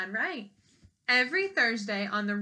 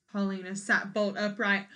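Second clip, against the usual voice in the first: ordinary delivery, nothing marked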